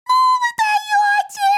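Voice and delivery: very high, loud, drawn-out squeak; squealing through laughter. A narrator's reading. Little man amazed at his guests, laughing squeal. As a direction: squeal in hilarity, stretching the words. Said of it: "сквозь смех запищал он".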